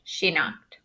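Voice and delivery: ordinary delivery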